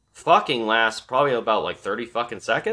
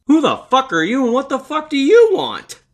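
much higher and louder, a mock shouted demand; overacted startled homeowner yelling at an intruder; hammed-up yell; 8 dB louder than usual. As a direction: yell it at the stranger in your driveway. Third replay, car in driveway.

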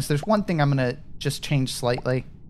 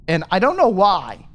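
normal talking voice

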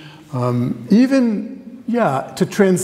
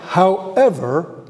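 a teasing, slow however, left hanging; drawn-out however; much slower than usual. Real, not acted. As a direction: stretch out however slowly with a rising end. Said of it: "there's a however".

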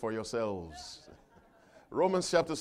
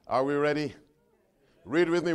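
ordinary talk, nothing marked

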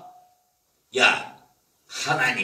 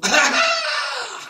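high scornful burst of laughter; mocking cackle. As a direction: laugh him to scorn, much higher. Rich man mocks heaven.

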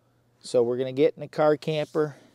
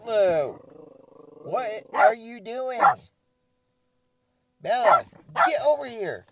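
alarmed; 12 semitones higher, 12 dB louder than usual. Real, not acted. Alarmed call to dogs.

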